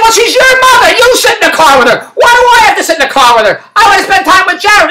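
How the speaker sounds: high-pitched exasperated comeback; indignant arguing. Retold or imitated. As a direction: re-enact a heated squabble. Retold quarrel over the car.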